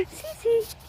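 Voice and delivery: high, loud coo to a horse; real thrilled hello; excited greeting. Real, not acted. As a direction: squeal the pet's name in delight.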